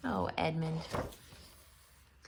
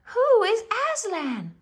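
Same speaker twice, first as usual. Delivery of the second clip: high, loud eager question; excited question; 14 semitones higher, 6 dB louder than usual. A narrator's reading. Lucy asking the beavers, high eager question. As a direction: burst out eagerly. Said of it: "with a shiver of excitement".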